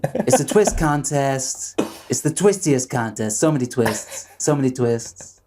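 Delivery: presidential parody as a contest host; Donald Trump impression. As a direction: hype the contest like the parodied politician. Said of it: "That's my Donald Trump impression".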